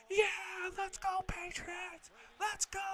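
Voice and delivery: very high mock fan shriek; shrieking imitation of an obnoxious fan; high-pitched scream. Rival fan cheering right in their ear, high-pitched scream. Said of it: "just this high-pitched scream".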